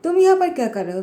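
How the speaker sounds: startled outburst. Acted. Jungkook bumping into Yuno at his door, startled and sharp. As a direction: jump at finding him there. Bumps into unwanted visitor at home.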